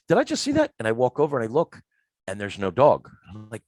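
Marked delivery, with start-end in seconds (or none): 0.1-0.7 high, surprised question to himself; can't believe his eyes; startled disbelief. Retold or imitated. Alone in the basement, a startled high question.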